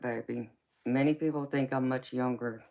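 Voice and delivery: ordinary delivery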